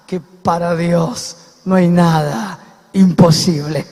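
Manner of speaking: low, loud, forceful declaration; emphatic preaching. Real, not acted. Preacher driving home God's power to listeners, low emphatic force. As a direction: drop the pitch and press hard on each phrase. Climax of the sermon's point.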